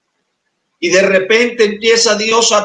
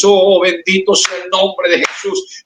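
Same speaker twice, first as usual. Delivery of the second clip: ordinary delivery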